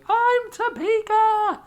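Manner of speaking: very high, slow called-out voice; calling out. Acted. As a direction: sing it out from far across. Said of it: "somebody called out".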